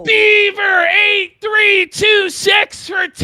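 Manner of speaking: high, loud donation cheer; hype shout. Real, not acted. Hyping donation.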